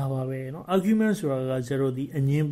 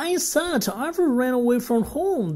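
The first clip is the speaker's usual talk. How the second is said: high, insistent raised voice; loud repeat; 10 semitones higher, 3 dB louder, much slower than usual. A narrator's reading. Boy repeating himself over lorry noise, raised voice. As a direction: repeat it higher, to be heard. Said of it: "his word loudly".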